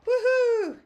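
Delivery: high, loud happy whoop; excited cheer. Real, not acted. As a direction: cheer it out in triumph. Celebrates fixing the box.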